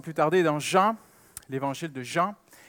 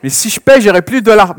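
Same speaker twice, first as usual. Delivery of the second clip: put-on higher, much louder childish protest; child's objection; 7 semitones higher, 17 dB louder than usual. Daughter protesting to her father about losing the dollar. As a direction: whine that the money will be gone.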